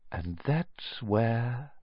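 lingering over the scar; hushed, slow. Acted. Old wandmaker touches Harry's scar, hushed and slow.

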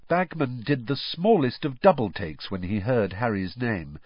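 usual speaking voice

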